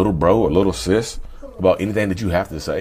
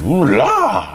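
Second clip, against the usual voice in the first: swooning ooh la; 8 semitones higher, 5 dB louder than usual. Pretend. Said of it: "paint it in a good light".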